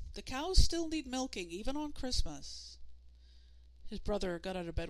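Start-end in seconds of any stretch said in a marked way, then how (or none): 0.1-2.8 loud, high calling from afar; calling mother. Acted. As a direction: call across the house, firm. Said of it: "called their mother from down the hall".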